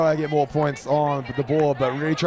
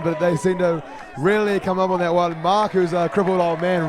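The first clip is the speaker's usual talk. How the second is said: normal talking voice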